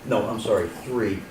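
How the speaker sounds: ordinary talk, nothing marked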